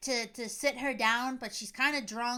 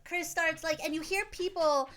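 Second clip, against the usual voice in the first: normal talking voice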